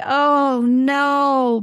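long drawn-out groan of dread; mock-dismayed, stretched-out moan; retold groan. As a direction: groan it out slowly, imitating a dismayed group. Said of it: "we would all just groan and say".

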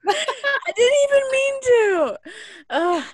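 high squealing laugh while protesting; laughing embarrassed. Real, not acted. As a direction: laugh it out, flustered and loud. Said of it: "He's just beet red".

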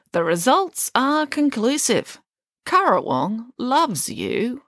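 stately presidential verdict; formal declaration. A narrator's reading. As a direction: declare it grandly, drawing out each word. President addresses the winner.

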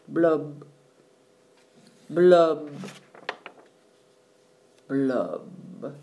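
low gloomy bubble blubs; slow sinking blub sounds. A narrator's reading.

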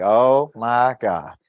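long, drawn-out groan of dismay; weary dread. Acted. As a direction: drag it out slowly, dreading the caller. Acted dread at client's call.

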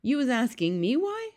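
high-pitched disbelief; incredulous cry. A narrator's reading. B F G answers Sophie's question, incredulous. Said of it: "cried the B F G".

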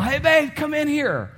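high excited call to the other room; calling out to spouse. Retold or imitated. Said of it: "And Jana or me would yell".

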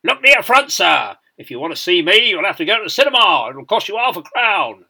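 loud, high sergeant major's rebuke; barked drill-square reprimand; parade-ground shouting. A narrator's reading. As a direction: shout it across the ranks at a cadet. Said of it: "by shouting".

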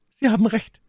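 high-pitched retort; indignant. A narrator's reading. Milady retorts in the quarrel. (empört, mit hoher Stimme)

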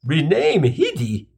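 slow, incredulous big guy; a hint of a gruff scoff; scoffing tough guy. Brawny guy scoffs at the idea.

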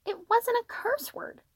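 put-on very high, slow kid voice; protesting child. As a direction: protest like a small boy being scolded. Child protests when scolded.

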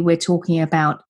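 normal talking voice

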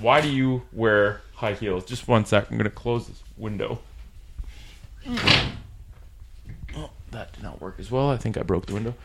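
off mic, distant and quiet; in a small room. Real, not acted. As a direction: voice far from the microphone in a small room. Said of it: "it might not be the best audio quality".

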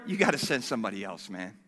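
low, soft, quick excuse; comic backing out. Acted. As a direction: duck the job in a hurried undertone.

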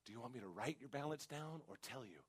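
hushed, very soft bank teller; imitated whisper. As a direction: lean in and ask discreetly, barely above a murmur. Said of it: "Why are you whispering?".

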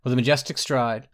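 usual speaking voice

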